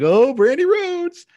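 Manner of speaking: high loud slow drawn surprise; mock surprise. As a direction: gasp out the name in fake surprise. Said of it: "I was like".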